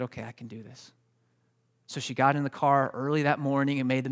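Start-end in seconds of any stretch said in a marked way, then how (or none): 0.0-0.9 small, low, very soft reply; timid agreement. Retold or imitated. Wife reluctantly agrees to drive to the meeting, hushed. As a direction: retell a hushed, hesitant okay. Wife's hesitant agreement, retold.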